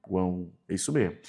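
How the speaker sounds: usual speaking voice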